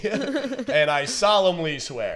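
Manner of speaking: mock solemn oath. Joking about swearing on the Bible, mock solemn. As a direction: draw it out like a sworn oath. Mimes courtroom oath as a joke.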